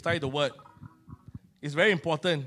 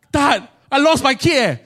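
louder, urgent plea for help; desperate plea; 6 semitones higher, 5 dB louder than usual. Retold or imitated. Father phoning his care group leader, urgent and panicked. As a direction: blurt it out in panic.

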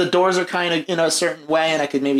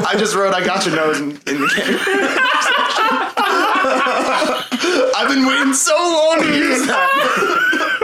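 high, gleeful laughing talk; laughing excitedly; 13 semitones higher, slower than usual. Real, not acted. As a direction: laugh through the words, thrilled.

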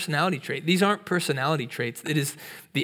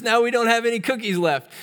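high, louder pretend wail; hammed-up tearful complaint in a raised voice; mock crying; 9 semitones higher, 5 dB louder than usual. Toad laments the lost cookies to Frog, mock crying. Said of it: "Toad starts crying".

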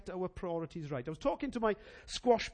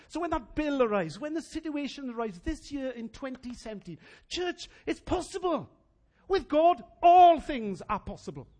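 rising, louder and higher toward GOD; church, with God all things are possible; preaching at full force; 6 semitones higher, 7 dB louder than usual. Acted. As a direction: build it to a shout of faith.

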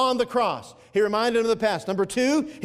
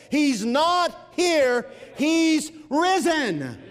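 slow, ringing and higher; triumphant proclamation; 7 semitones higher, much slower than usual. Acted. Preacher proclaiming the angel's words to the church, slow and ringing. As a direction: announce it like the news of the age.

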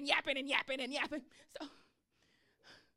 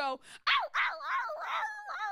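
very high mock yelps of a hurt dog; dog yelping imitation; 4 dB louder, faster than usual. Imitating her hurt Yorkie yelping, very high mock yelps. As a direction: squeal out the ows like a small dog.

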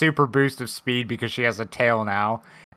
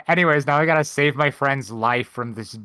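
ordinary talk

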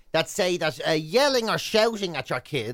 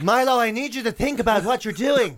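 higher-pitched, put-on American parent voice; weird American accent; 4 semitones higher than usual. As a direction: gently reason with a child, mock American. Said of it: "that sort of weird American accent".

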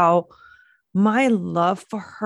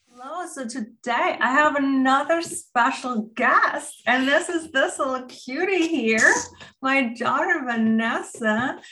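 high, sing-song mommy voice; bright and sweet, as if to a toddler; talking to a child; 9 semitones higher than usual. Acted. Mom introduces her daughter to the podcast, high sing-song.

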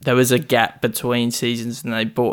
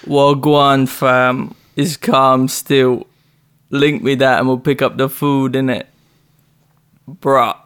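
put-on London roadman accent. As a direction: lay on the roadman slang accent. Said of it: "I just gotta get into character".

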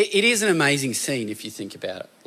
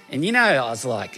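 normal talking voice

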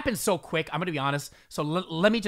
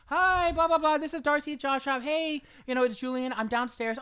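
higher, chirpy mock office receptionist; 8 semitones higher than usual. Imitating the Job Shop receptionist on the intercom, mock cheery.

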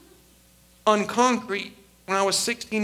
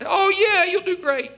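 mock yes-men; 4 semitones higher than usual. Pastor mimicking flatterers who cheered him on, in a higher, gushing voice. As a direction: gush like eager yes-men. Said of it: "I had a lot of yes people".

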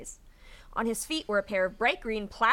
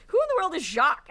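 baffled elder; 9 semitones higher, 6 dB louder, faster than usual. A narrator's reading. Confused elder voiced in story.

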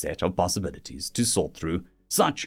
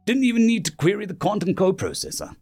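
scornful officer, pitched higher; harsh scorn; 7 semitones higher than usual. A narrator's reading. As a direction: report with open scorn for the enemy. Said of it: "The scorn in the tactical officer's voice was harsh".

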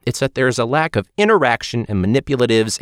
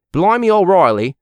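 higher, British-accented exclamation; put-on British accent; 5 semitones higher, 3 dB louder than usual. Shown as a demonstration. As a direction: try it out in British slang style. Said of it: "borrowed from the Irish".

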